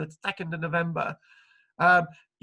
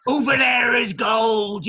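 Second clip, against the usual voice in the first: slow, loud, hammed-up pirate call; mock pirate shout; 2 semitones higher, 6 dB louder, slower than usual. Pirate telling the Scots where the gold is, slow mock shout. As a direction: bellow it slowly like a stage pirate.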